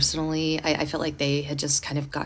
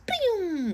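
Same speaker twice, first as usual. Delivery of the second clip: long high "boom" sliding down; whooshing getaway noise, pitched high then falling; imitated zoom; 13 semitones higher than usual. Imitates speeding off home.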